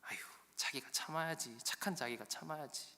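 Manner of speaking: softly coaxing a sweetheart; acted tender cajoling; soothing. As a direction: placate her in a hushed voice. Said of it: "라헬을 달래는 거죠".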